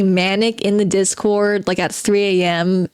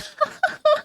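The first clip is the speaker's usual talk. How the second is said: high burst of laughter; 3 dB quieter than usual. Real, not acted.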